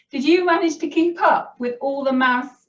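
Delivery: usual speaking voice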